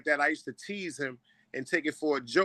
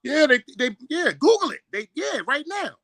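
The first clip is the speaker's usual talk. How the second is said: higher and louder, eager to be believed; excited insistence; 7 semitones higher, 6 dB louder than usual. Real, not acted. Answering a skeptical co-host about chips, loud excited insistence. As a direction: push back fast, go check it.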